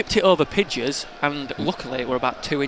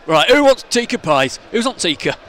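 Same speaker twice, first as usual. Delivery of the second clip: mock fan calling out; 3 semitones higher, 6 dB louder than usual. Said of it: "one big guy looking up and going".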